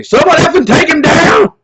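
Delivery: high, loud yell of exasperated friends; full-volume imitation of screaming friends. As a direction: scream it like a fed-up crowd demanding a takedown. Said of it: "literally they were just screaming".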